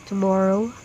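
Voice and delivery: ordinary talk, nothing marked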